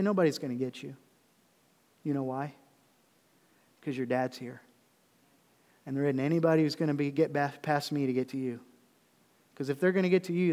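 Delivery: low, soft reassurance; steady fatherly comfort for a frightened child; encouraging dad. Retold or imitated. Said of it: "then I would encourage them".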